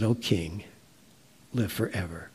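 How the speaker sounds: a gentle, lightly played greeting from below; cheerful voice. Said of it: "it was a cheerful voice".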